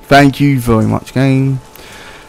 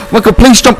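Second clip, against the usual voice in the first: ordinary talk, nothing marked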